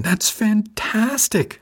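high, drawn-out delighted cheer; grinning delight. A narrator's reading. Colleague hears she passed the exam, grinning delight. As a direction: stretch it way up with a huge grin. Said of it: "face splitting in a grin".

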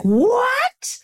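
high, drawn-out "what?" of mock shock; retold disbelief. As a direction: squeal it in stunned disbelief. Retold reaction to getting the part.

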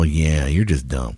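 slow, low, quiet verdict; imitated slow, dismissive conclusion; deadpan realization. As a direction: let it sink in, acted. Voices people's slow realization.

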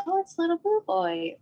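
a hint of a small child's high voice; light toddler voice; lightly voiced little girl. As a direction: answer sweetly like a toddler. Daughter voiced in story.

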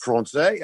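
excited Français? with French vowels; wife asking in French again. Retold or imitated. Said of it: "She didn't speak English at all".

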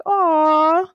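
a moved little aww; touched aw. Retold or imitated. Host recalls her reaction to the Hound, a touched aww. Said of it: "I was like".

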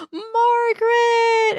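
high, slow, delighted cry of the name; excited reveal. Real, not acted. Hosts cheering a favourite character, high delight. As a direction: stretch the name out in glee.

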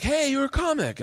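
very high, hammed-up greeting; excited welcome. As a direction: imitate delighted club staff. Imitates welcoming club staff.

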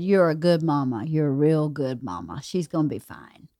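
soft, low comforting words; quoting Whitney softly. Retold or imitated. Wife retelling Whitney's comfort in the lobby, soft and low. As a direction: murmur it like a kind stranger. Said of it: "she said".

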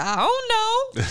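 high, slow imitation of a child; sad little boy. Son answers mom's question about yelling, woeful child voice. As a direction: draw it out high like an unsure kid. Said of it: "looking really sad".

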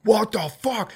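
high, stunned exclamation; shocked outburst. Retold or imitated. Hearing the son's news from his dad, stunned. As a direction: blurt it like you can't believe it. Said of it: "and I was like".

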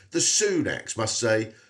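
ordinary delivery

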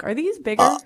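normal talking voice

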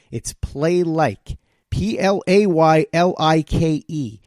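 "PLAYLIKE" said slowly, then "P L A Y L I K E" letter by letter; spelled-out promo code. Shown as a demonstration. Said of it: "the promo code I'm about to give you".

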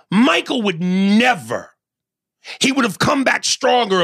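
high-pitched, incredulous sports rant; heated argument. Real, not acted. Host condemning a star joining the rival team, heated. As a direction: argue it high and emphatic, leaning on key words.

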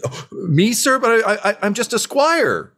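put-on high, squeaky, flustered young voice; startled squire. GM as Nibs, amazed the knight picked him, high and squeaky. Nibs surprised at being chosen.

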